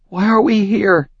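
frightened. Acted. Frightened heroine in darkness.

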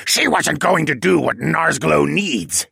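loud, high, slow penguin squawk; hateful squawk. A narrator's reading. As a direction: squawk it out with hatred. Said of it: "the penguin squawked with a hateful glare".

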